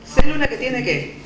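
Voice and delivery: fast, loud question thrown to the class; teacher pressing the class for the answer; loud quiz. Real, not acted. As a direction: fire the question at the students. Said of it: "teacher quizzing class".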